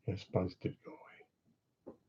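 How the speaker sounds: slow, soft whisper for a joke; mock whisper. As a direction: breathe it like a secret. Joke about the buzz.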